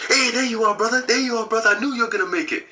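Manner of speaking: high, happy welcome, quickening at the end; the elder welcoming him at last, bright and high; joyful greeting. Retold or imitated. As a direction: greet a long-awaited brother with delight.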